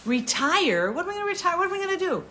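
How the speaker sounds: playfully aghast at the idea of retiring; high, amused outrage at the thought; mock disbelief. Real, not acted. Viewer asks her never to retire.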